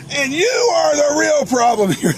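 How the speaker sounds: stretched high-pitched heckle; retold yelling. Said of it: "yelling at him".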